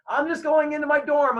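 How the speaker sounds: high, loud call over the shoulder; hurried brush-off. Retold or imitated. Brushing off police while walking away.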